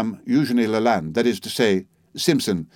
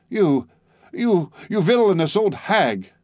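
higher, slow outraged name-calling; outraged insult; 7 semitones higher, slower than usual. Acted. Narrator insulting the old woman, outraged and higher. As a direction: stammer then hurl the insult.

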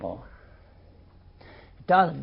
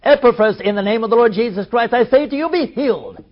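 mock faith healer; 5 dB louder, faster than usual. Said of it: "why didn't Paul say".

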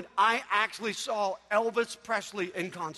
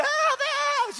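very high, drawn-out screech; piercing mock concert shriek; mom's frenzied fan scream; 4 dB louder than usual. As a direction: imitate a hysterical fan screaming for her idol. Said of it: "Mom's just going".

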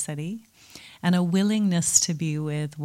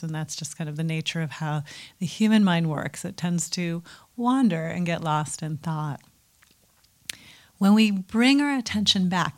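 usual speaking voice

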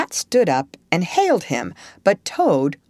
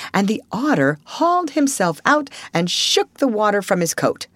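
plain reading voice

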